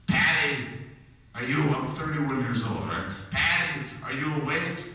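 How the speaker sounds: mother on the phone. Retold or imitated. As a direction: call out like an eager mother. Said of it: "It's my mother".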